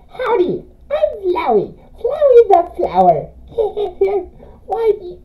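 high, sing-song flower voice; sweet but sinister Flowey. Acted. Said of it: "that flowery voice".

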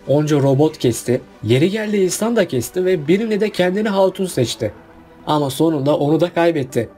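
ordinary delivery

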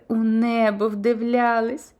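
ordinary delivery

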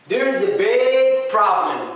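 slow, every word spaced out; slow, careful demo. Shown as a demonstration. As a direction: read it slowly for learners. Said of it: "تبان لك الفرق". (ببطء ووضوح)

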